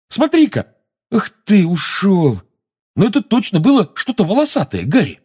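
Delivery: put-on excited boy voice. Seamus excited about his patronus.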